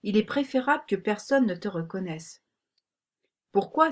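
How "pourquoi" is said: higher-pitched puzzled question; surprised. A narrator's reading. Girl told to hide in the wagon, surprised question. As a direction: ask with a lift of surprise. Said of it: "s'étonna Élodie".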